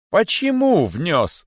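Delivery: high, very drawn-out accusing question; king's shout. A narrator's reading. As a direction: cry it out as an accuser. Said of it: "закричал король". (обвиняющий крик короля)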